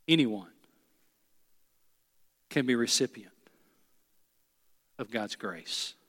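low, soft, hushed invitation voice; altar call invitation. Acted. Preacher leading the congregation in bowed prayer, hushed. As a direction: draw them in gently with heads bowed.

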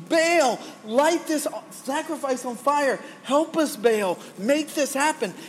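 high, frantic mock scream. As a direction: plead up high, frantic and desperate. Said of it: "they're screaming more frantically".

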